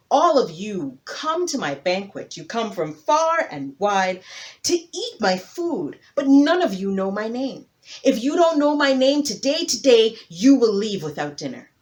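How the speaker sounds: loud, proud king addressing his guests; a big hippo king laying down the rule; booming hippo king. Acted.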